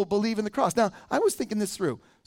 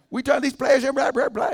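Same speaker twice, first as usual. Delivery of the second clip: shouted mock Southern coach accent, much higher; 6 semitones higher, 4 dB louder than usual. Preacher imitates the coach firing up his team, shouted mock accent. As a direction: bellow it like a fired-up Southern coach. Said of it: "he's like".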